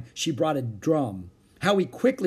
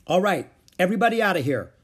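fast, loud, higher order; 7 semitones higher, 6 dB louder, faster than usual. A narrator's reading. Beezus ordering the children out, loudly. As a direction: herd them out briskly. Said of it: "Beezus ordered".

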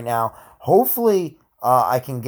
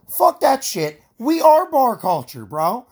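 much higher and louder, hyped up; excited rant; 11 semitones higher, 6 dB louder, slower than usual. Real, not acted. Host rallies listeners behind bar culture, pitched up and loud. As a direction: fire it up like a rallying cry.

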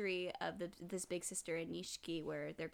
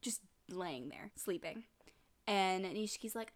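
ordinary talk

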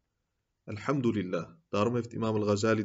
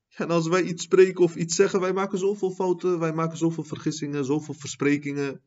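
preach; 5 semitones higher, 4 dB louder than usual. Acted.

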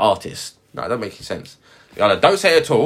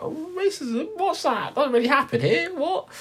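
very high, mock-innocent voice, much higher; mocking oblivious locals; 4 dB quieter than usual. Mocking Chichester locals in a high, clueless voice. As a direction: play dumb about racism in a high squeaky voice. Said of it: "people in Chichester were like".

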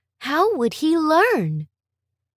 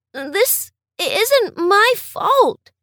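tearful plea; 8 semitones higher, much slower than usual. Acted. Crying boy pleads.

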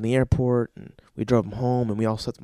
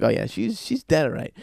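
casual agreement in usual voice; matter-of-fact. Acted. Boyfriend answers wailing girlfriend, matter-of-fact agreement. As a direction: confirm it without any hysteria. Boyfriend calmly confirms bird's death.

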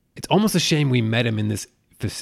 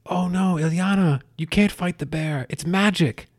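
higher, pleading cry of a character; mock panicked warning; 9 semitones higher than usual. Recapping the movie, pleading with Illyana not to fight, higher mock alarm. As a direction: play the worried teammate calling out to stop her. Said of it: "when she's like".

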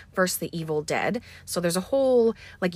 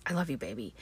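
low, soft father's voice; grieving father; 6 semitones lower, 9 dB quieter, faster than usual. Retold or imitated. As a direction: say it low and quiet to his dead daughter. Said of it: "he says".

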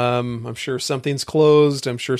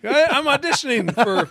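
higher, laughing overblown podcast read; eager audition; 10 semitones higher than usual. Acted. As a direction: ham up a podcast intro. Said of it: "I'm auditioning for, for the role of podcaster".

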